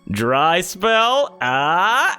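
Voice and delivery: mocking NPC greeting. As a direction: needle an old acquaintance. Slash Claw greets Lawman mockingly.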